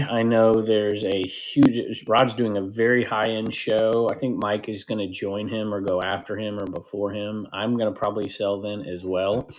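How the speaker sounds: ordinary talk, nothing marked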